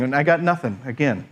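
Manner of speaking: ordinary delivery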